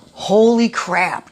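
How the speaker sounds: drawn-out, loud, high-pitched amazement; amazed. Real, not acted. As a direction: let it burst out high and slow, awestruck. Amazed reaction to forgiveness post.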